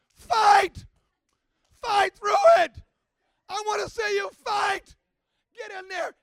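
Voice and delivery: fierce, high-pitched shout; tenacious mother. Retold or imitated. Mother urging her son to fight, tenacious shouting. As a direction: yell it like a tenacious dog at the fence. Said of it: "like a tenacious dog".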